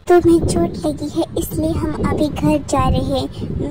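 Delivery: ordinary delivery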